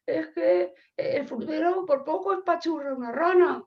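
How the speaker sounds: put-on high, whiny child's voice; child playing policeman. Child as policeman explaining the frog scare, high childish complaint. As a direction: complain like a little kid tattling. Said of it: "el niño que hace de policía dice".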